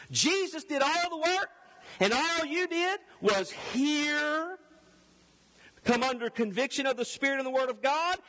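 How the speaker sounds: high-pitched, insistent pulpit voice; forceful preaching. Acted. Preacher pressing salvation on his congregation, forceful and high. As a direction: drive it home like a fired-up preacher.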